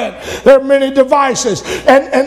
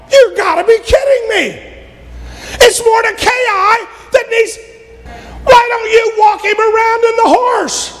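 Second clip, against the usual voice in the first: outraged king; 11 semitones higher than usual. Retold or imitated. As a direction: shout it at Haman in disbelief.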